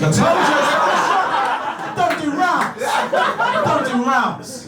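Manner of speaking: high-pitched, exasperated insistence; comic high-voiced scolding; mock outrage. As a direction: protest in a squeaky, worked-up voice.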